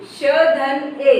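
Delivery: very slow, each letter spelled apart; drawn-out phonics breakdown; slow letter-by-letter spelling. Shown as a demonstration. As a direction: spell it out slowly for children. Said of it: "यानी श में ए की मात्रा शे".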